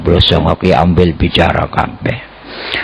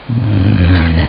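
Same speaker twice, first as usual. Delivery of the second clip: normal talking voice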